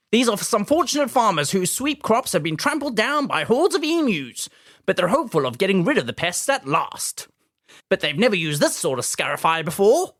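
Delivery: put-on high-pitched vintage newsreel narrator accent; old newsreel Mid-Atlantic accent. Podcaster imitating a wartime newsreel narrator for co-host, high Mid-Atlantic accent. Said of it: "kind of English accent that, that you can probably visualize in your head".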